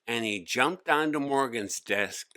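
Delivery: ordinary delivery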